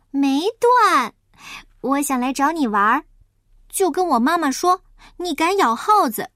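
high, lively piglet voice; little pig character. Acted. Little pig asks Huahua for help, high and lively. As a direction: pipe up high and urgent.

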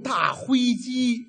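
put-on slow, soft baby talk. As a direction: say 大灰机 slowly like a toddler. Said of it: "mock baby talk".

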